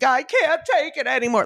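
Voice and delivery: high-pitched dramatised meltdown; mock overwhelmed wail. Acting out own overwhelmed breakdown.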